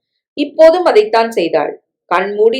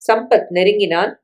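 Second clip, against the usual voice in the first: ordinary delivery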